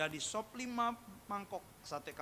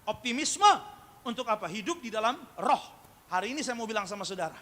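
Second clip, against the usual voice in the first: loud sermon with shouted bursts on key words; forceful preaching; 2 semitones higher, 13 dB louder than usual. Real, not acted. Preacher urging the congregation to master themselves, forceful. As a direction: preach it hard, punching out the commands.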